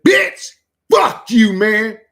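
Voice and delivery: very high, loud, slow squeal of outrage; mock outraged yell. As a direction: shriek it like a hurt friend. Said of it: "I was like".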